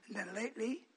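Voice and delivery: ordinary delivery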